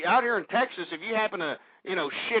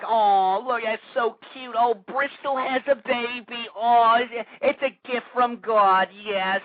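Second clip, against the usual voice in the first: mock gushing; 3 semitones higher, slower than usual. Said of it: "They were like".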